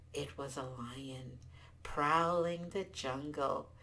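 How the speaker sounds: usual speaking voice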